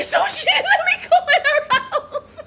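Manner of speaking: high, slow squeal as the bird; laughing bird imitation. Woman imagining the bird's thoughts, laughing imitation. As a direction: crack up while voicing the bird.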